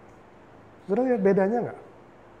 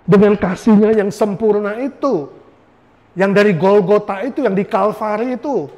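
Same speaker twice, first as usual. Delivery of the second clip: louder, ringing sermon climax; preaching at full force; 4 semitones higher, 7 dB louder than usual. Acted. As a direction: proclaim healing to the congregation. Altar-call appeal.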